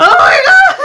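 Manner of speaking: high, loud cry through laughter; thrilled high shriek; excited squeal. Real, not acted. Thrilled reaction to a video.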